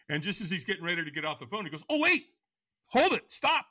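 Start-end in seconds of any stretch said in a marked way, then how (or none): 1.9-3.7 very high, loud retold halt; urgent interruption. Buddy stops him getting off the phone, urgent. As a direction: imitate a friend cutting in before the hang-up.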